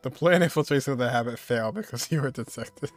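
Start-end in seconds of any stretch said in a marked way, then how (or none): none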